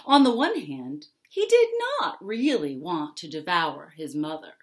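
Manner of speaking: ordinary delivery, nothing marked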